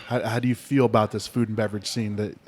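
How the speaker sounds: ordinary talk, nothing marked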